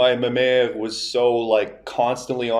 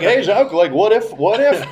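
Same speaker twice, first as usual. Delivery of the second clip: high, louder attention-seeking call; insistent grandma; 9 semitones higher, 5 dB louder than usual. Retold or imitated. Grandma retries her joke on distracted grandpa, insistent imitation. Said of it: "And then she's like".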